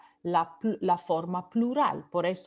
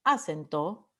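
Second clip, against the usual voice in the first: "acento" misstressed as A-cento, first syllable punched; wrong first-syllable stress; 4 semitones lower than usual. Shown as a demonstration. Teacher showing what we don't say, "acento" stressed on the first A. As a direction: hit the A of "acento" instead of CEN. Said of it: "porque no decimos acento".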